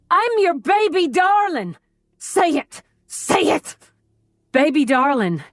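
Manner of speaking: put-on a furious little boy screaming for his name; tantrum childlike captor. A narrator's reading. Captor demands she call him baby darling, high tantrum. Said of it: "his eyes wild".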